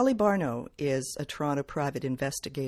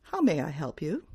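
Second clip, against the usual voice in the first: brisk businesslike phone greeting, starting higher; a touch of polished receptionist; professional phone voice; 8 semitones higher, 3 dB louder than usual. Acted. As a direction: answer like a poised office pro. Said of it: "said in my most professional voice".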